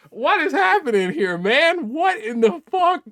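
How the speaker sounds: high-pitched incredulous questioning; exasperated, high bewilderment; baffled disbelief. Real, not acted. Baffled by the commenter.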